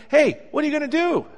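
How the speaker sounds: high, loud, pushy challenge; nagging accusers. Retold or imitated. Crowd nagging Jesus while he writes in the sand, high and loud. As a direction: needle him with a loud high demand.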